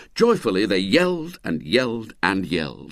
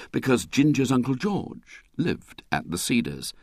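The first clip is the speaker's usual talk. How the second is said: usual speaking voice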